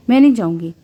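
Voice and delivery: plain reading voice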